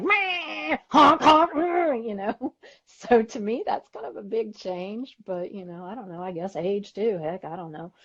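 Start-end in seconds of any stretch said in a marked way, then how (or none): 0.0-2.1 high, loud car-horn honks; mock road rage. Driver acts out her old road rage, hammed-up honking. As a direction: imitate furious honking and snarling noises. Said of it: "before I'd be just like".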